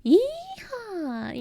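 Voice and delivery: very high, drawn-out cowboy whoop. Shown as a demonstration. Streamer showing viewers the idea of an American accent, high cowboy yell. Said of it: "idea of an American accent is very".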